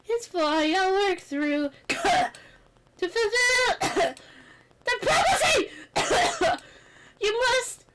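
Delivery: coughing through the words; choking. Acted. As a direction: choke out the prophecy. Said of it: "spice stuck in my throat".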